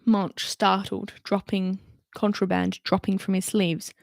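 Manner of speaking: ordinary delivery, nothing marked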